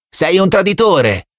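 high, slow accusing yell; boy shouting. A narrator's reading. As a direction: spit out the accusation like a zealous child. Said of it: "gridava il ragazzino". (grido accusatorio di bambino, lento)

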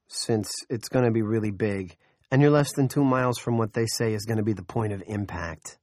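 ordinary delivery, nothing marked

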